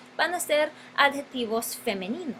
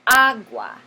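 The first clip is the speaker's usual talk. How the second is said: AGUA with a loud, punched first A; "agua" with heavy emphasis on A; stressed initial A; 8 dB louder than usual. Shown as a demonstration. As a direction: hit the first syllable of "agua" hard, then fall away. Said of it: "empieza con una A enfatizada".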